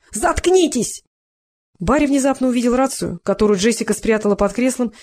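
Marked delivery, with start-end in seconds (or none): 0.1-1.0 высоко, на срыве; выкрикнуть, теряя контроль; истеричный вопль. A narrator's reading. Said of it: "вскричал Эл". (высокий истеричный крик)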